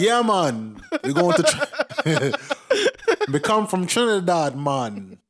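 exaggerated American take on a Jamaican accent, high and laughing; mock Jamaican accent. Mocking how Americans fake a Jamaican accent, in a hammed-up Jamaican accent. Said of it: "it's how Americans think Jamaicans sound".

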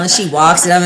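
ordinary talk, nothing marked

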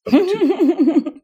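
words squeezed out through a laugh, slow and high; cracking up at his own joke. Real, not acted.

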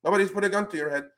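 high, fast mocking parody of politicians. Podcast host mocks politicians' excuse in a fast, high voice. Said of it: "they go".

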